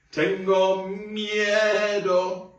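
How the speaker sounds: high, slow, hammed-up scared wail; be a bit silly, pretend to be scared; silly mock fear. Shown as a demonstration. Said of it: "say it like I am afraid".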